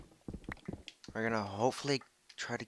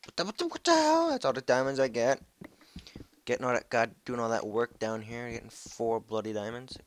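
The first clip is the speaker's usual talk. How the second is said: loud, much higher furious yell; angry shout; 4 semitones higher, 8 dB louder than usual. Real, not acted. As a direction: explode in outrage. Few diamonds, angry outburst.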